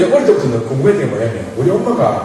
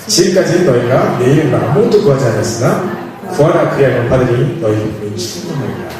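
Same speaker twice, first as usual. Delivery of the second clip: steady, formal Bible recitation; scripture reading; 2 semitones lower than usual. Acted. Preacher leading the congregation in reading verses, formal. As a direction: read it like scripture aloud together.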